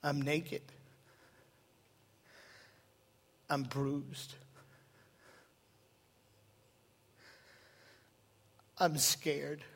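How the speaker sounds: quiet penitent whisper-like voice; hushed prodigal's plea. Acted. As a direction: speak it low and broken, like a son coming home.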